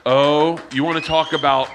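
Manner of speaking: ordinary delivery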